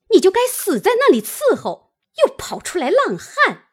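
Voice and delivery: fast, loud, high-pitched tirade; angry cursing. A narrator's reading. Mother slaps her daughter and curses her, fast and furious. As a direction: berate her without letting up. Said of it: "骂道".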